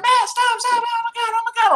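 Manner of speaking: very high, squealing mock panic; panicked little bartender. Little bartender begging him to stop, panicked high voice. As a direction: imitate a tiny woman pleading in alarm. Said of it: "this little girl about ninety pounds".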